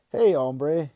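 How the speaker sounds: loud, high greeting in a bad Mexican accent. A narrator's reading. Dad voices the stuffed gecko, in a bad Mexican accent. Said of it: "in his worst Mexican accent ever".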